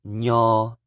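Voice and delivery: "nyo" clear with palatal N; teacher drilling ny syllable; Hmong nyo. Shown as a demonstration. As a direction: say "nyo" cleanly. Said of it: "N Y is pronounced".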